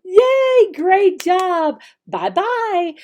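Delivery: high, loud, delighted praise; big cheer. Shown as a demonstration. Teacher praising a young student, high and loud. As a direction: cheer the kid on, really high. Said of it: "That's how you teach it".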